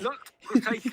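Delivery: high, loud, wet lisp; spluttering philosopher caricature; Zizek. Acted. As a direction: force out the lisp in a foreign accent. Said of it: "force out a fucking lisp".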